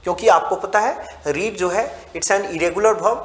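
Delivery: normal talking voice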